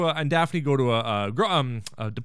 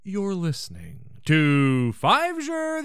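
low, drawn-out radio host greeting; radio host parody; 3 semitones lower, much slower than usual. Acted. Podcast host greets Seattle listeners, mock radio voice. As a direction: stretch it out like a pompous radio host.

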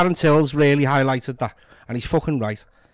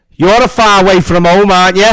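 imitated homeless fella; 9 dB louder than usual. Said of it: "homeless fella, goes".

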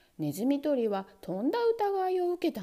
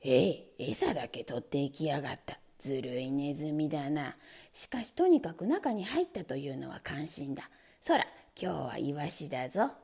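low, gruff complaining man; grumbling angry man; 9 semitones lower than usual. Acted. Man finding the bait gone, low and cross. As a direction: grumble low about the sly mouse. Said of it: "怒って言いました".

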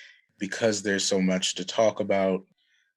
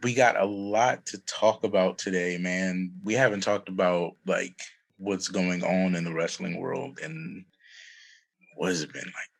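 ordinary talk, nothing marked

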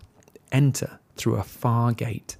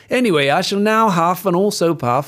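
pompous wolf; 6 semitones higher, 4 dB louder than usual. Acted. Wolf announcing threat.

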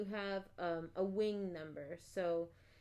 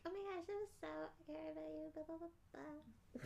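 high, soft, gushing parent voice; higher and softer, a teasing imitation of a family member gushing; mock gushing family; 8 semitones higher, 13 dB quieter than usual. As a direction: coo it like an overly doting relative. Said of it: "my family listening to this and then being like".

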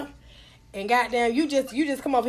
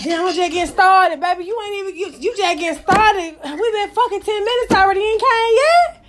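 pitched-up comic disbelief; mock protesting lover; 6 semitones higher, 4 dB louder than usual. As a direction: imitate a disappointed lover objecting. Voicing the lover's surprised protest.

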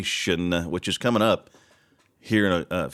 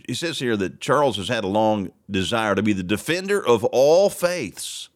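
usual speaking voice

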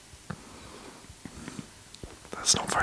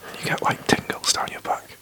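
hushed podcast whisper, breathy and close; 3 semitones lower than usual. Real, not acted. Whispers all through podcast.

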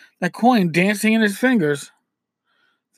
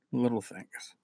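much softer, lower echo; hushed repeat; 7 semitones lower, 13 dB quieter than usual. Real, not acted. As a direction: let it sink in, very quietly.

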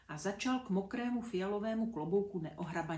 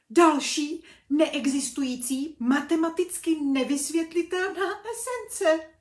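louder and higher, panicked shriek; 7 semitones higher, 10 dB louder than usual. A narrator's reading. Overwhelmed teacher shrieks at the ghost. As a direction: yell it as you lose your mind. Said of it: "a tak zaječel".

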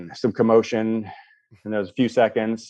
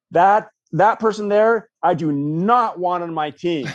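higher, slow and pointed; mock emphatic refusal; 6 semitones higher, slower than usual. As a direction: reject him from your team with mock drama. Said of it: "I'd be like".

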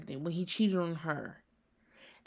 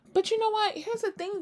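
pitched way up to tease; high-pitched lead-in; 14 semitones higher than usual. Real, not acted. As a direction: swing high as if sharing gossip.

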